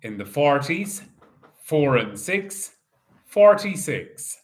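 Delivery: slow, drawn-out number calling; bingo caller. Acted. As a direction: call each ball out steadily.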